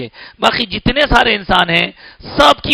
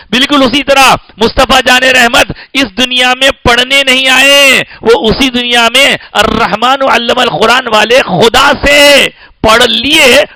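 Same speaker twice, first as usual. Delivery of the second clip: raised, ringing sermon climax; full-force preaching; 4 semitones higher, 5 dB louder than usual. Real, not acted. Preacher tells the congregation whom the Prophet learned from, fervent proclamation. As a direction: proclaim it higher and louder to the congregation, drawing out the final words.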